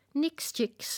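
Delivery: high whispered chirp; playful bird. Acted. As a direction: toss it off cheekily. Nightingale speaks.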